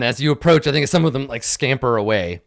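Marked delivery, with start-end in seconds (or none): none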